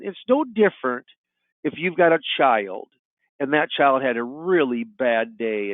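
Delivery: ordinary talk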